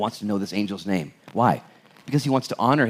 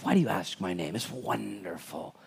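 much softer, reverent hush; hushed awe; 2 semitones lower, 10 dB quieter than usual. Pretend. Angel answering Manoah's question, hushed and reverent. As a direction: let the mystery sink in.

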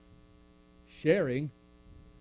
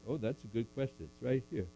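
normal talking voice

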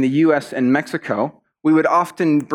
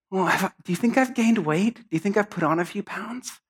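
put-on higher, softer girlish voice; self-conscious girl; 6 semitones higher, 5 dB quieter than usual. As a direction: ask timidly, fishing for reassurance. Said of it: "all the girls would be like".

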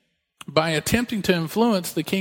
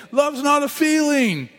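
put-on high, slow child's voice; a kid stating a grown-up truth; imitating his young son; 9 semitones higher, slower than usual. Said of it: "even Asa was saying".

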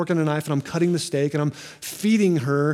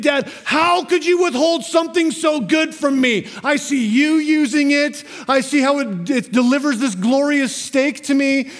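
put-on higher, louder whiny toddler wail; mock toddler tantrum; 7 semitones higher, 4 dB louder than usual. Pastor voicing his toddler demanding the knife, mock tantrum. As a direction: ham up a furious little girl. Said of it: "She screamed at me".

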